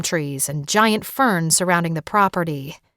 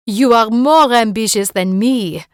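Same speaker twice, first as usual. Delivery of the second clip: put-on louder, bright French-accented exclamation; exclaimed; 3 semitones higher, 6 dB louder than usual. Rosalie marvels at Erin's ambition, loud French-accented exclaim. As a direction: exclaim it with delighted surprise, French lilt. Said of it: "Rosalie exclaimed".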